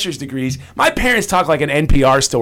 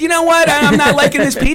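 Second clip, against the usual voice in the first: mock declaration; 10 semitones higher, faster than usual. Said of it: "I said".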